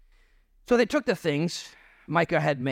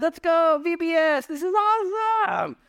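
giddy excitement. Retold or imitated. Priest lining up with the children, high and giddy. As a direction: squeal it like an eager kid. Priest joins the kids in line.

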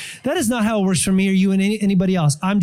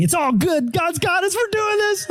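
rising higher and higher with excitement; pumped; 13 semitones higher than usual. Retold or imitated. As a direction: burst out, thrilled to tell the wife. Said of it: "I was so pumped".

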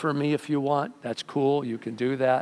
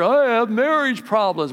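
mock complaint; 8 semitones higher, 5 dB louder than usual. Voicing a complaining churchgoer.

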